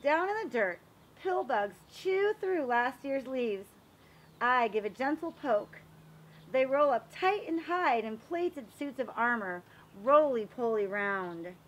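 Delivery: lively, sing-song picture-book reading; reading to children. A narrator's reading. Reading a garden picture book to children, lively storytelling. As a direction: read it like story time for little kids.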